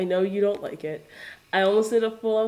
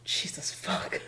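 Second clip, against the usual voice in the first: whispered curse; 9 dB quieter, slower than usual. Real, not acted. After taking a shot.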